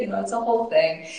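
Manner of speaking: ordinary talk, nothing marked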